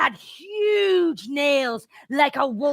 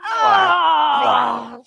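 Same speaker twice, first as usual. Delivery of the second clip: long disgusted cry; 3 semitones higher, 8 dB louder than usual. Acted. Reacting to Feeney throwing up, long disgusted cry. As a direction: cry out in revulsion.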